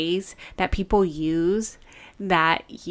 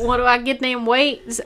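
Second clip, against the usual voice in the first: echoed country twang, pitched up; country accent; 5 semitones higher than usual. Pretend. Echoing a co-host's question about weights, mock country accent. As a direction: play along in a country voice. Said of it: "why do we have to go country".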